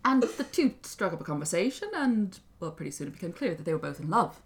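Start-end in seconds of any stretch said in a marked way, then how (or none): none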